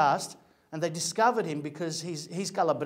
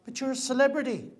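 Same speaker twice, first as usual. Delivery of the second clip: higher, mock amazed disbelief; incredulous; 8 semitones higher than usual. He marvels at the celebrity's chores, higher and incredulous. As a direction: act floored that a star does chores. Reacting to celebrity's mundane day.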